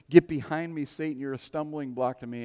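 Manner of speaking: loud, punched GET; sudden rebuke cutting the disciple down; sharp rebuke. Retold or imitated. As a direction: snap it out as a rebuke. Jesus' rebuke retold sharply.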